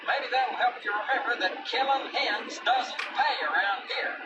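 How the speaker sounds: scolding ranch owner. Acted. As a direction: mildly scold the dog, disappointed. Glover punishes Hank.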